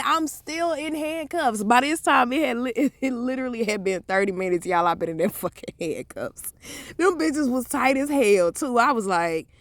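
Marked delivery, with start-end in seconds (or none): none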